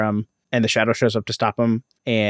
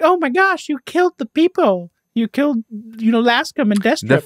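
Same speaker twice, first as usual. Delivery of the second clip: very high, mock-distressed damsel; hammed-up falsetto panic; 13 semitones higher than usual. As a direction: squeal it like a helpless damsel. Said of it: "instant damsel in distress mode".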